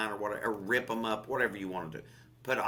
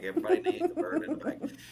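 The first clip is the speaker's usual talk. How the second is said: laughing; faster than usual. Real, not acted. Joking catchphrase through laughter.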